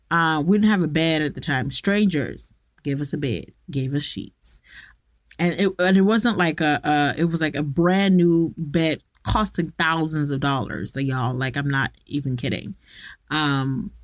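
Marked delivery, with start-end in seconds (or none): none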